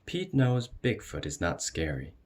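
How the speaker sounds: ordinary delivery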